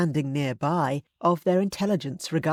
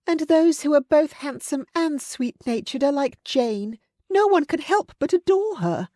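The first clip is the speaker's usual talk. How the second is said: put-on higher, louder girlish voice with a sigh; sighing admirer; 11 semitones higher, 4 dB louder than usual. A narrator's reading. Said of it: "Sarah Long sighed".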